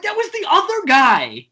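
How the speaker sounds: high, slow, drawn-out objection; indignant protest. Retold or imitated. Wrongly named drummer blaming the paperwork, indignant protest. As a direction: insist it's a mix-up, voice way up. Retold protest at court mix-up.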